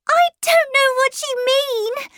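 put-on very high, loud child's scream. A narrator's reading. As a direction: scream it like an upset little girl. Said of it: "she screamed".

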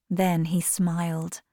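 usual speaking voice

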